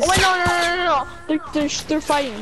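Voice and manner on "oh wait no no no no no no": panicked no's. Real, not acted. Enemy squad suddenly appears.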